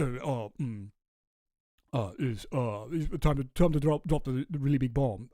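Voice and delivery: put-on half-hearted South African accent as Musk; Elon Musk impression. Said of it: "let me try to do a Musk accent".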